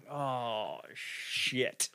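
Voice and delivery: soft, breathy oh shit; whispered realization. Retold or imitated. Host realizing he owed the comic money, whispered. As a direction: drop to a near whisper, caught out. Realizing he never paid him.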